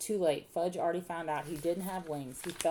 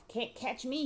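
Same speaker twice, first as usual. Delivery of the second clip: put-on high-pitched toddler taunt; hollering; 8 semitones higher, slower than usual. A narrator's reading. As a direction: taunt them like a little boy running off. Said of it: "he hollered".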